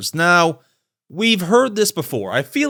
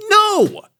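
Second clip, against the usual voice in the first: normal talking voice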